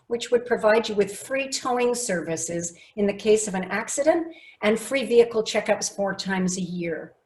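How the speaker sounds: one long unbroken sentence without pauses; run-on pitch. Shown as a demonstration. Coach demonstrates version one for car buyer, run-on delivery. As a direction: rattle through the pitch without stopping to breathe. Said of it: "It was broken down into short sentences".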